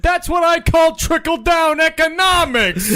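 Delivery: loud, slow, brash Dice Clay shout; Andrew Dice Clay voice. Acted. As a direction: bellow it like a brash comic. Joke punchline as Dice Clay.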